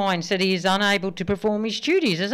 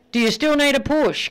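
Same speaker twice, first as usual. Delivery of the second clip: higher, louder call; 7 semitones higher, 4 dB louder than usual. Acted. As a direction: shout it across the yard. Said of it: "calls the husband".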